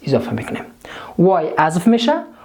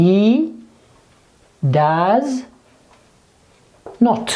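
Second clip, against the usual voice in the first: each word alone, long pauses between; spaced-out dictation, waiting after every word; 2 semitones higher, much slower than usual. Shown as a demonstration. Teacher dictating the negative form to students, word-by-word dictation.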